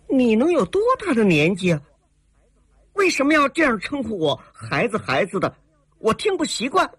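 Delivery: high, quick indignant challenge; cold, offended. A narrator's reading. Heroine objects to being called child, coldly offended. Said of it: "寒声说".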